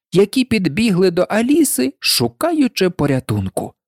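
ordinary delivery

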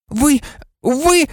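very high, stammered accusation; high outraged girl; horrified accusation. A narrator's reading. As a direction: gasp it out high, aghast. Hermione's horrified accusation of Lupin.

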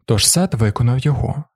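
usual speaking voice

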